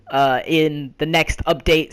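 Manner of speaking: normal talking voice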